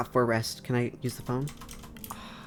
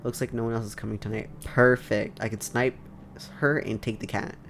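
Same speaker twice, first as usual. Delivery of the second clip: ordinary delivery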